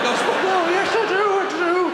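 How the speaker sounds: desperate excitement. Retold or imitated. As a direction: blurt it out desperately. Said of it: "I'm like".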